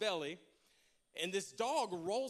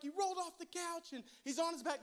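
freaking out; 8 semitones higher than usual. Retold or imitated. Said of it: "So I'm freaking out".

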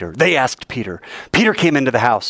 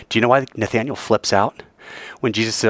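usual speaking voice

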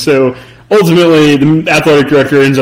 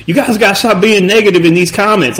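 attempted North Carolina accent; 4 semitones higher than usual. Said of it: "they were like".

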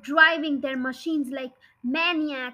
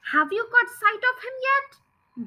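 calling back; 9 semitones higher than usual. Acted. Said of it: "Bean called back".

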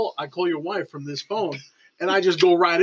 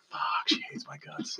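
hushed, whispered dread to himself; dismayed mutter; 11 dB quieter than usual. Retold or imitated. Man reacting to a friend's wife's voicemail, dismayed whisper. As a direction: say it under your breath, sinking.

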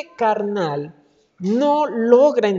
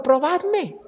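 raised, inviting challenge; a hint of a divine dare, pitched up; voicing the Lord's challenge; 8 semitones higher, 4 dB quieter than usual. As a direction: dare them gently to try it. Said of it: "y después dijo".

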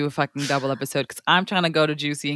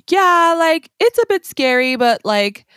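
higher-pitched, casual imitation of locals; New Yorkers shrugging it off; 4 semitones higher, 4 dB louder than usual. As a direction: play the unbothered local. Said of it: "people who live there are like".

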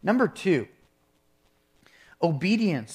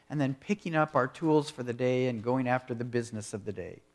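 normal talking voice

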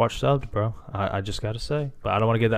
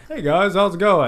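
high, louder imitated greeting; everyday friendly greeting; 8 semitones higher, 5 dB louder than usual. Imitating friend greeting people he knows, high easy voice. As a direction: call it out high to pals. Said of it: "You're just like".

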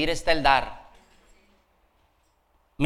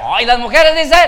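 very loud, high rallying shout; shouted call; 8 semitones higher, 13 dB louder than usual. Real, not acted. Preacher setting women against men for amens, shouted call. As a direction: shout to whip up a response.

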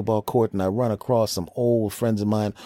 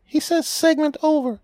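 squeaky high voice; 3 dB louder than usual. Acted. High voice answers.

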